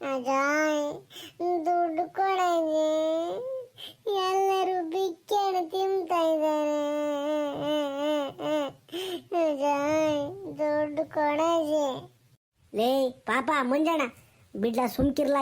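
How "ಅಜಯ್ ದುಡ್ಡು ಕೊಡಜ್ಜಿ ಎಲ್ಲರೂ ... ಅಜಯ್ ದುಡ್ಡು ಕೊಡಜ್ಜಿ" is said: slow, wailing plea to grandma; acted tearful whining; crying child. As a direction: drag the words out into a childish bawl. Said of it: "ಯಾಕೆ ಅಳ್ತೀಯ".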